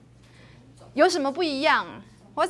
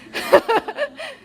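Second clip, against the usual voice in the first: laugh; 11 semitones higher, 4 dB louder than usual. Real, not acted. Laughing after singing demo.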